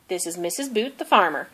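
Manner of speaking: ordinary delivery, nothing marked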